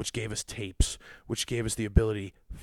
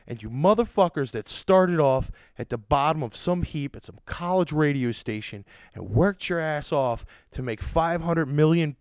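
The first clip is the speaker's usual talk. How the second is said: louder, higher, heated tirade; furious raised-voice rant; angry rant; 4 semitones higher, 5 dB louder than usual. Real, not acted. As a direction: push the rage through every word. Ranting at famous radio host.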